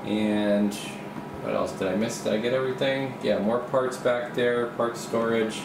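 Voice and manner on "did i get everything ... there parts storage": normal talking voice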